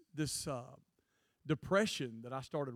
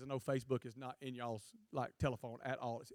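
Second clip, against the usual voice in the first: normal talking voice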